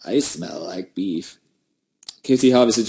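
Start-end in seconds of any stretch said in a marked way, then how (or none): 0.0-1.4 vine quote impression. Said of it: "I love that vine".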